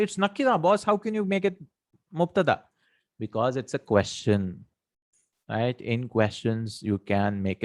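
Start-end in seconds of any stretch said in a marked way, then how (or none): 0.0-2.6 high-pitched mock objection from a student; objecting student. Teacher voicing a student's objection, mock high-pitched protest. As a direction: play the cheeky student who pushes back. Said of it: "But you might say".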